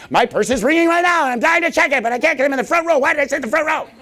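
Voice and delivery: high-pitched frantic mock whine; hammed-up inner panic of someone caught out; imitating panicked audience member. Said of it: "She's like".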